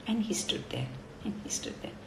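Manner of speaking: whispered repetition; soft whispered storytelling. A narrator's reading. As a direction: hush it as time drags on. Anthill standing still.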